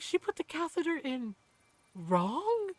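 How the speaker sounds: high-pitched disbelieving question, last word held back; retold incredulity. Ron hearing about the catheter mistake, incredulous.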